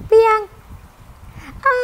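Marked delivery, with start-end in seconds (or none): 0.1-0.4 high, loud call across the forest. Acted. A lost wife calls out for help in the rain, loud and high. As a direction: call his name into the rain.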